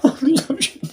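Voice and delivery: high, weak words through laughter; laughing from rage. Real, not acted. Gamer so angry they could cry, laughing from rage. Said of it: "sinirden gülüyorum".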